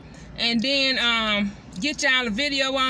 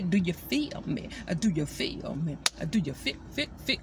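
silly deep voice; 9 semitones lower, 8 dB quieter than usual. Pretend. Teasing her viewers with a goofy low voice. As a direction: drop the voice way down and play crazy. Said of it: "y'all know I am batshit crazy".

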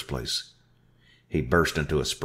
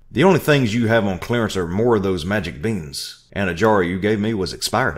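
loud, higher shout; 5 semitones higher, 7 dB louder than usual. Acted. Narrator shouting at Orville about beans, loud. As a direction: shout the complaint. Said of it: "I shouted".

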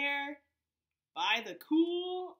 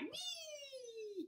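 high soft squeal of joy; Frog cries wee; 13 semitones higher, 12 dB quieter than usual. Acted. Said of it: "and frog cried".

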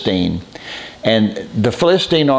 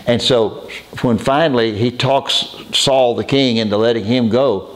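ordinary delivery

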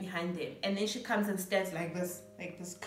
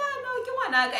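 much higher, louder mock protest; high-pitched retort; 6 dB louder, much faster than usual. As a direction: shoot the pitch way up in disbelief. Retelling her own reply.

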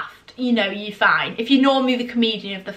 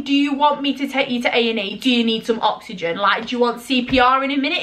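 normal talking voice

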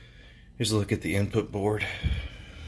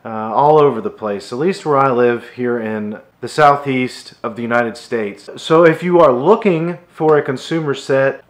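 louder, higher, projected wrap-up to viewers; energetic vlog sign-off narration; presenter voice-over; 6 semitones higher, 9 dB louder than usual. Acted. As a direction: deliver a punchy, emphatic video outro.

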